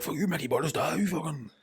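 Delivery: a high, soft taunt; mock workmate jeer. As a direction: tease him like a workmate. Work mates mocking him.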